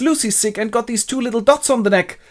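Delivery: high, put-on anxious voice; worried. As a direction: report symptoms in mock alarm. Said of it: "Arthur is worried and goes".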